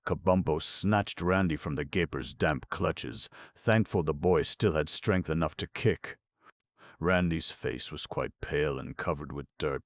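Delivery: ordinary delivery, nothing marked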